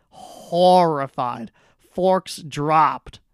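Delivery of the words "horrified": slow, drawn-out horrified; Horrified said slow and heavy; stressed repeat. Real, not acted. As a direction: stretch the word Horrified out slowly. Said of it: "looked horrified".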